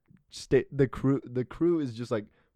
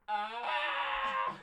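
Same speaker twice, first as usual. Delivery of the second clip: long high wild yell into the woods; Bigfoot call scream; 6 dB quieter than usual. Shown as a demonstration. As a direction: howl out like calling Bigfoot. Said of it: "that scream that you did is shockingly close".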